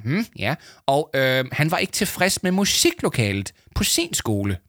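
high voice, hesitant, throat-clearing; self-satisfied boaster stalling. Acted. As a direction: stall as if waiting to be fed the answer. Leo listens to his earpiece.

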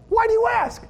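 hammed-up defensive question; mock defensive. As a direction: play guilty innocence. Defensive retort acted out.

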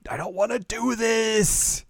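high, slow reluctant plea; a hint of a timid girl refusing; reluctant whine. Kanan's reluctant reply retold.

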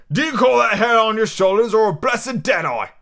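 loud, high pirate scolding; exasperated innkeeper at full voice; crying out. Acted. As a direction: berate a hapless sailor. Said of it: "cried Long John".

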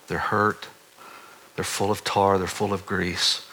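a quiet, reverent preaching voice; hushed preaching. Acted. Voicing God's words softly.

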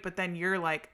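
ordinary talk

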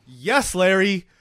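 emphatic cheering on. Retold or imitated. Viewer cheering on the angry husband, slow emphatic approval. Host cheers on character while watching.

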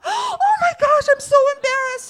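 gasp, then loud high-pitched squeal; hammed-up squeal of embarrassment; mortified outburst. Woman who mistook a passenger for staff, mortified. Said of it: "she's mortified".

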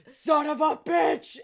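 loud, slow passionate shout; yell. Retold or imitated. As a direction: draw out a big passionate roar.

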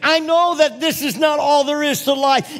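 high, loud, ringing proclamation; full-force preaching. Real, not acted. Preacher proclaims eternal hope to congregation, full-force preaching. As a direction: preach it at the top of your voice.

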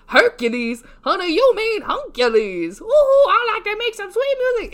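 loud, high, sassy movie-quote voice; imitating a Muse. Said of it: "where she's like".

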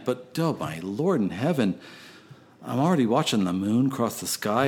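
ordinary delivery

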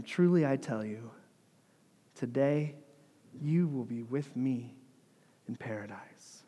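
soft, pausing reassurance that fades; gentle promise. Retold or imitated. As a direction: comfort a dying man quietly. Jesus reassures the dying criminal.

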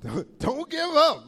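high, loud, drawn-out urging; big emphatic exhortation; rallying shout. Real, not acted. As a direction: holler encouragement to the room. Rallies the congregation.

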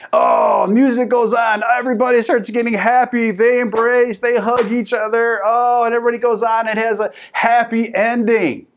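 high, excited happy-ending montage; mock gushing. Preacher retelling the movie's happy ending, mock gushing.

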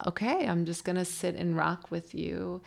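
very soft, soothing retold voice; calm reassurance. Father rocking his son, calm and soothing. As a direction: imitate a patient parent settling in quietly. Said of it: "he is calm enough".

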